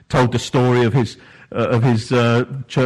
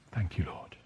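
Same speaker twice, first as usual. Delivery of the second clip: breathy whisper, barely voiced; whispered thanks; 4 semitones lower, 12 dB quieter than usual. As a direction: whisper it like a closing prayer. End of voiced prayer.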